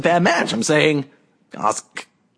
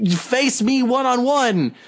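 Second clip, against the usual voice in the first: higher, drawn-out mock challenge; 7 semitones higher, slower than usual. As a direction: slowly imitate a wrestler throwing down a challenge. Voicing a wrestler's challenge.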